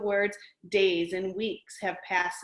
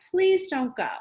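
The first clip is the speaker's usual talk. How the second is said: high, loud mock plea; pleading cry; 8 semitones higher, 5 dB louder than usual. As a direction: beg him to stay like a sad monster. Wild things cry as he leaves.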